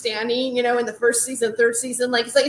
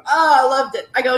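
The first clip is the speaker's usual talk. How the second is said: ordinary talk, nothing marked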